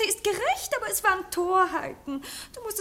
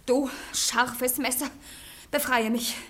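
mock tragic; 8 semitones lower, 4 dB quieter than usual. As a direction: ham up a fake suicide. Wife fakes suicide.

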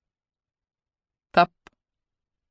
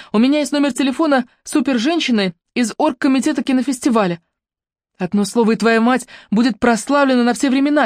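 lightly voiced young woman, higher and eager; Ruby, confident; 7 semitones higher than usual. Ruby makes Claude an offer, higher and eager. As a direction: sell him the plan.

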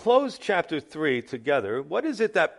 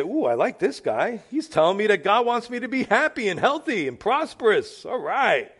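mock-pleased couch viewer, pitched higher; delighted viewer; 6 semitones higher than usual. Preacher mocking an online viewer, pleased higher voice. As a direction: ham up a viewer who loves what he hears. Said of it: "Then they say".

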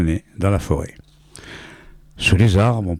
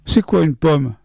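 put-on high, wide-eyed child asking father; little boy voice; 8 semitones higher than usual. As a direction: play the small son, much higher. Child asks about apple.

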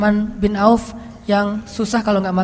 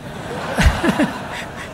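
breathy laugh; 3 semitones higher, 5 dB quieter than usual. Real, not acted. Laughing at own joke.

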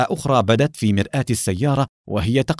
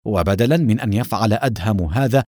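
plain reading voice

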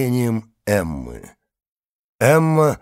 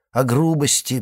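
usual speaking voice

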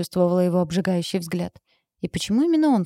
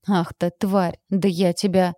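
usual speaking voice

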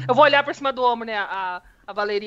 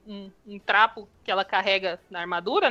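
ordinary delivery

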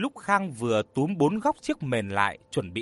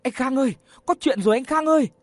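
much higher, louder, fast call; 10 semitones higher, 5 dB louder, faster than usual. Acted. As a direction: shout for Khang from upstairs. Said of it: "Mỹ Phương gọi lớn". (gọi lớn hốt hoảng)

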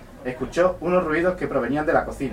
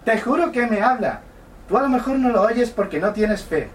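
man doing a woman's voice; 7 semitones higher than usual. Acted. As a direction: insist in a high woman's voice. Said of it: "voy a poner voz, mm, de mujer".

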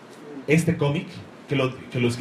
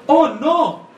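a much higher, louder hammed-up oh no; exaggerated mock alarm; 12 semitones higher, 8 dB louder than usual. Jay Garrick teasing the terrorists, a hammed-up high shriek of fake fear. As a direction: overplay the fake fright for laughs. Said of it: "Jay, no seas exagerado".

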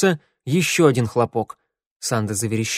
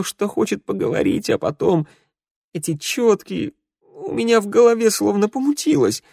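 high, rising into a sob; blurting it out through tears; tearful confession; 9 semitones higher than usual. A narrator's reading. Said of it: "Голос его сорвался на крик, и он захлебнулся плачем".

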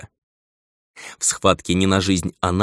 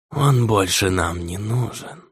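put-on slow, softer menacing old voice; cold gritted order; 4 dB quieter, slower than usual. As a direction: bite the words out coldly. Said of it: "процедил Даралайла".

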